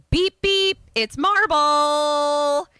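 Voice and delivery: high beep, then a long drawn-out announcement; high-pitched heralding call; called-out arrival. Acted. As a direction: honk it high, then stretch the name out loudly. Acting out a car horn arrival.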